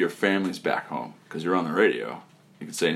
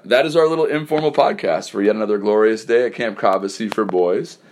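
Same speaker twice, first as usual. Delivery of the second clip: slightly louder announcer's goodnight; radio sign-off; 4 dB louder than usual. Acted. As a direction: wrap up the show like a host.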